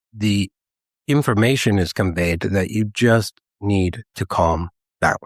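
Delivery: usual speaking voice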